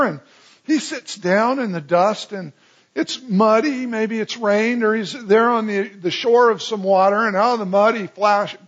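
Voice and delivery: ordinary talk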